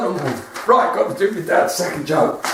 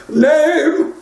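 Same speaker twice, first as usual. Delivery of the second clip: higher, louder demand; Saint Peter voice; 6 semitones higher, 4 dB louder than usual. Retold or imitated. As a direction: call the next soul up, impatiently. Saint Peter repeats himself.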